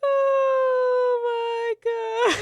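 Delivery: high, loud laughing shriek; real hysterical laugh and cry; shrieking laughter. Real, not acted. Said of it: "reacting to song".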